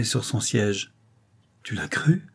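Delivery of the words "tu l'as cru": terrified. Acted. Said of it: "dit-il épouvanté".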